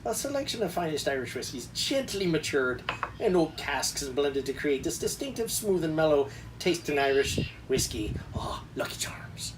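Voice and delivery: hammed-up, badly faked Irish accent; lousy Irish accent. Reading whiskey blurb to viewers as a joke, lousy Irish accent. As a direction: put on an awful mock-Irish accent. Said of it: "That was my lousy Irish accent there".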